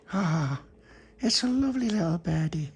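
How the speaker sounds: put-on high cooing child voice; high-pitched adoring "ah". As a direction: coo high over something tiny. Pauline coos over the birdie.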